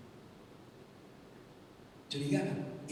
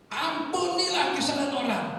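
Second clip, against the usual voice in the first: preacher's command; 12 semitones higher, 8 dB louder than usual. Acted. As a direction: proclaim it to a huge crowd. Preacher points at him, retold. (seruan pengkhotbah yang lantang)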